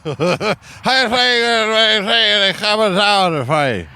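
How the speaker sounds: high, slurred urgent hollering; drunk captain babble. Pretend. Drunk Irish sub captain warning the crew, slurred hollering. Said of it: "impression of a drunk Irishman piloting".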